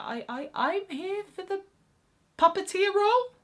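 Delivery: high, halting, nervous stammer; mock stutter. Flustered auditionee explaining to the accompanist, high nervous stammer. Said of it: "to stutter out".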